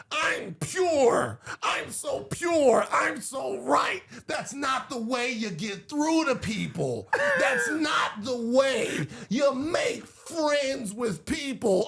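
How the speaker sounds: high-pitched, drawn-out mock pleading; Jink impression. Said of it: "can I do a Jink impression?".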